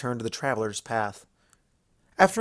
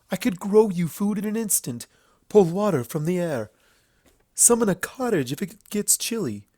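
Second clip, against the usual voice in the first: higher, boastful royal voice; haughty princess; 7 semitones higher, 3 dB louder than usual. Acted. Luna boasting to Applejack she can handle anything, haughty. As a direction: brag about her powers.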